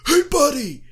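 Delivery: high, loud mock heckler voice; heckling fan. Fan in front correcting the brother, high mocking heckle. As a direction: call him out, mocking. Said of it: "just like".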